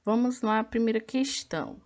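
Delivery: ordinary delivery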